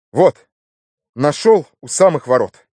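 a lightly voiced proud youngster; eager announcement. A narrator's reading. Nephew showing matches to the inspector, eager. Nephew shows off his find.

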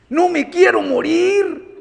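trembling plea. Retold or imitated.

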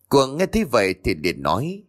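usual speaking voice

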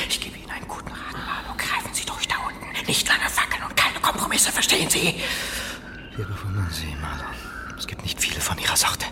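tonlos geflüstert, eindringlich; verschwörerisches Flüstern. Acted. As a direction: raunt ihm den Rat ins Ohr. Müller flüstert Marlow Rat zu. (Müller flüstert Marlow schmeichelnd zu)

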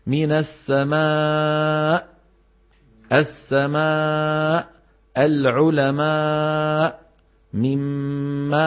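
السماء and العلماء drawn very slowly with a firm closing glottal stop; firm final hamza. Shown as a demonstration. Teacher drilling the final hamza in slow examples. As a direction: stretch السماء and stop it cleanly. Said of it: "لابد من إيه؟".

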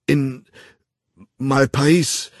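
disjointed, agitated stammer. A narrator's reading. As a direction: break off between words, flustered. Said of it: "stammelte der Wilde unzusammenhängend".

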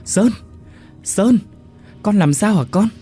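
high, loud frantic calling; mother frightened on the phone; panicked mother. Acted. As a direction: call out to your son in terror. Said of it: "Bà Liên hoảng sợ".

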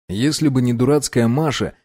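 plain reading voice

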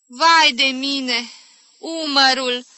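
slow, loud wail at a twinge of pain; pained exclamation. Acted. Aunt wincing at her aching shoulder, pained exclamation. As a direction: cry out loud and high, drawn out. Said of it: "făcut odată o strâmbătură și exclamă".

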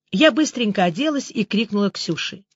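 ordinary delivery

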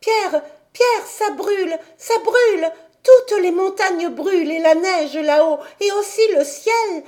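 put-on high, loud child's alarm and wonder; excited cries. A narrator's reading. Heidi sees the mountains burning at sunset, crying out excitedly. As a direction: call out breathlessly amazed, urging him to look. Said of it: "en s'écriant".

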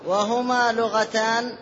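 ordinary talk, nothing marked

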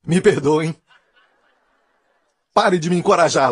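apologizing through laughter; real laughter. Real, not acted. Preacher begging the audience to stop encouraging him, laughing. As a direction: talk while cracking up at your own act. Cracks up after his own act.

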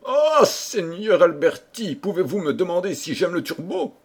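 high, gushing royal delight; delighted exclamation. A narrator's reading. The king overjoyed at being asked about turbot, delighted. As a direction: exclaim with relish, pitched well up. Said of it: "dont les yeux brillèrent soudain de plaisir".

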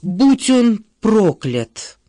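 slow, loud groaning curse; moaned curse. A narrator's reading. Woman cursing Banks a second time, loud drawn-out moan. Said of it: "простонала она".

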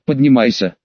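ordinary delivery

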